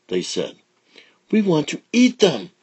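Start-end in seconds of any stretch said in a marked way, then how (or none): 1.3-2.5 put-on squeaky eager child; high little alligator.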